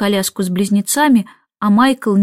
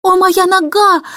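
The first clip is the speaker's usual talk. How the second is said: much higher, louder wail; 8 semitones higher, 3 dB louder than usual. A narrator's reading. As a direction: wail it out over the broken china. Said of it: "громко причитала горничная Эллен". (громко причитая, высоко)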